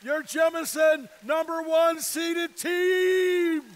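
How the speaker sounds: slow, stretched arena call; announcer shout. Real, not acted. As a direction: hype the crowd, draw it out. Announcing top seed to crowd.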